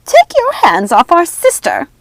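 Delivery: high, loud witch shout; angry witch shouting. A narrator's reading. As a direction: bark the order at her. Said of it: "Ruby shouted".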